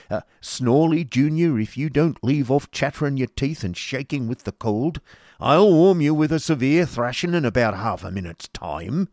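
put-on high, loud schoolmaster bullying a shivering boy; Squeers threatening. A narrator's reading.

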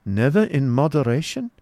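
high-pitched incredulous question; questioning Baron. Acted. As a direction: ask it high, rising at the end.

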